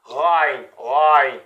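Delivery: as if lightly spitting, rein; rein, comme si tu crachais légèrement; throaty rein. Shown as a demonstration. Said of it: "Comme si tu étais en train de cracher".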